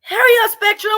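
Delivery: loud, high impatient shout. Real, not acted. Streamer shouting at her internet provider to hurry up.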